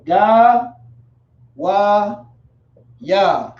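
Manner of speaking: Ga Wa Ya one syllable at a time; Ga, Wa, Ya as separate, drawn-out beats; sounded out. Shown as a demonstration. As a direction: stretch each syllable of Ga Wa Ya, pausing between. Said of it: "sounded out in the Hebrew".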